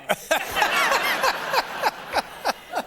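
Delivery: long high laugh; big laugh. Real, not acted. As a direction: burst out laughing. Laughs at own story.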